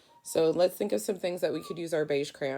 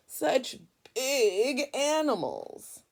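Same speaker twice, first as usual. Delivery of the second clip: high, slow, exhausted groan of a sentence; drawn-out weary complaint; 8 semitones higher, 5 dB louder, much slower than usual. Acted. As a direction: stretch it out like the load is crushing you. Tired crayon complains.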